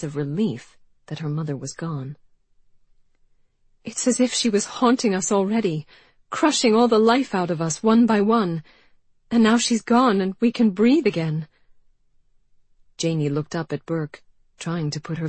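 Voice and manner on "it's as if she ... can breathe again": emotional outburst. A narrator's reading. Janey pours out her feelings.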